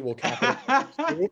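high laughing voice. Real, not acted. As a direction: laugh through the words. Laughing at the pause.

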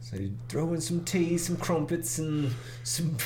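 a hint of a cheeky Englishman; a light mock English accent. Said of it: "you're just English saying Jamaican".

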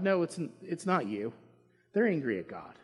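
ordinary talk

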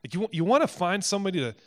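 ordinary talk

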